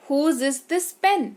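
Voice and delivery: slow, careful teaching read; slow model sentence. Shown as a demonstration. Teacher modelling sentence slowly for students. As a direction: say Whose is this pen? word by word. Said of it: "teacher models English sentence".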